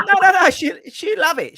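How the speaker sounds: high, loud eager pushing; eager coaxing. Retold or imitated. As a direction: talk him into it with glee. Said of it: "he's going".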